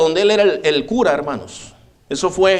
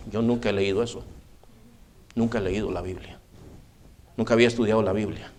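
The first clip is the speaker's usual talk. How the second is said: quiet admission; 7 semitones lower, 9 dB quieter, faster than usual. Retold or imitated. Priest admits never reading Bible.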